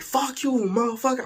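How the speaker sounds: high-pitched mock cursing; cussing back. Date hurls another curse at storyteller, mock-angry. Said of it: "literally throwing fuck yous at each other".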